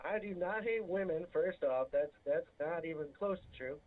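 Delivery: normal talking voice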